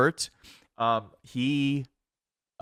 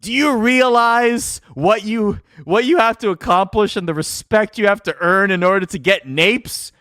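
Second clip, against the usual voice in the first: mock incredulous; 9 semitones higher, 7 dB louder than usual. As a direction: drag it out like a scandalized veteran. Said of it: "he's incredulous. He's not, he's putting it on".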